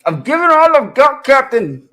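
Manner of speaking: put-on loud, high Scottish accent; straining Scottish engineer impression; Scotty. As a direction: shout it in a hammed-up Scottish brogue. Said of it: "Scotty or something like that from Star Trek".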